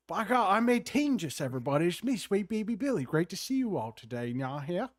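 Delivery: showy Southern character impression; Southern lawyer up an octave; Sweet Baby Billy. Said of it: "I can do Sweet Baby Billy's voice".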